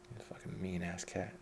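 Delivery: usual speaking voice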